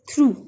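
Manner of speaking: through said as throo, ending in a long oo; model pronunciation. Shown as a demonstration. Teacher showing pupils how through is read, clearly. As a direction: say through like threw. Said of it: "इसको पढ़ते हैं".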